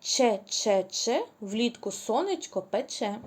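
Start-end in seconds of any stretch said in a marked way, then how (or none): 0.0-1.2 each syllable spaced out slowly; slow, deliberate syllables; slow syllable drill. Shown as a demonstration. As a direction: spell the rhyme out slowly for children. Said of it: "я читаю рядочок".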